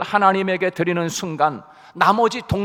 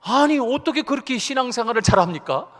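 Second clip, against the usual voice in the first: higher-pitched acted amazement; retold surprise, pitched up; astonished question; 4 semitones higher than usual. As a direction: marvel in disbelief, drawing out the opening. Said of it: "깜짝 놀래가지고서".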